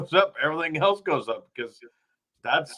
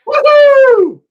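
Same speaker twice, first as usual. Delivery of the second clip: excited cheer; 16 dB louder than usual. Real, not acted. Cheering as the show returns.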